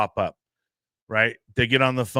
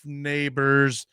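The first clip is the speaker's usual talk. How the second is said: ordinary talk, nothing marked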